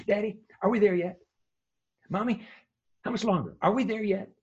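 put-on high, pleading kid asking from the back seat; mock child whining are we there yet; whiny kid. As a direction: nag like an impatient child. Impatient kids in the car.

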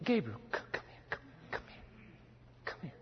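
very soft whisper; hushed, secretive summons; God confiding. Acted. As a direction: whisper it to draw a listener close. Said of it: "And he says".

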